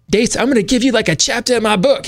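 imitating a JFK-style Boston teacher; New England accent. Teacher praising student in a mock New England accent. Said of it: "He had, like, this New England accent".

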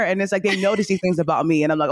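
ordinary talk, nothing marked

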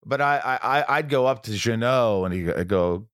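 usual speaking voice

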